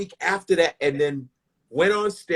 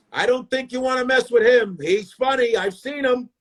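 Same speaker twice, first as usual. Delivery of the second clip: higher-pitched put-on man's voice; 7 semitones higher than usual. Audience member warning the woman off, higher imitated voice. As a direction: imitate a man piping up in warning. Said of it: "One of them stood up and said".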